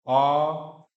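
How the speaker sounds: "आ" held long and loud; long vowel. Shown as a demonstration. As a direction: stretch "आ" out for two beats. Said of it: "इसमें लंबा खींचना है".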